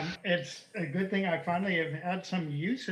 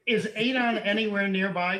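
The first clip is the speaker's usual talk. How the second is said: normal talking voice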